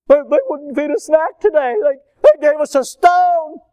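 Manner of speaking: put-on outraged kid tattling, high and loud; indignant complaint. Child complains to parent about camp food, indignant. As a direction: wail it in high-pitched disbelief.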